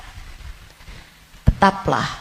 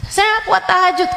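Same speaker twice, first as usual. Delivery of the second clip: fast, pitched-up whine; high whiny complaint; 10 semitones higher, faster than usual. Acted. A congregant to the ustazah, high and whining. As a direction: protest it quickly and high.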